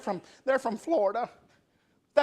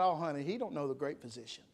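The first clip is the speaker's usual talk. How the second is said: soft, tender hush; gentle nurse; 7 semitones lower, 10 dB quieter, faster than usual. Retold or imitated. A nurse softly comforting a frightened wife, tender and quiet. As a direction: soothe her like a kind nurse.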